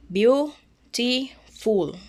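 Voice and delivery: "beautiful" stretched slowly, beau-ti-ful, each syllable drawn out; slow syllable split. Shown as a demonstration. Teacher to students, counting syllables, drawn-out beau-ti-ful. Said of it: "three syllables".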